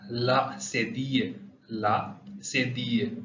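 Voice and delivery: ला से दिए drawn out slowly, French cedilla; teacher spells out ला से दिए syllable by syllable; French name said slowly. Shown as a demonstration. Teacher naming the accent to students, slow and careful. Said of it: "इसका नाम है".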